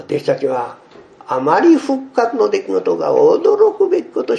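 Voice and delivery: usual speaking voice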